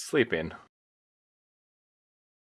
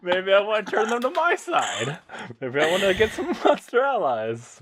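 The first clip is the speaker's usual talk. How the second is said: laughing; 11 semitones higher than usual. Real, not acted. Joking, laughing through it.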